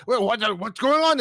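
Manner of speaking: confused pirate. Retold or imitated. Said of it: "the pirates goes like".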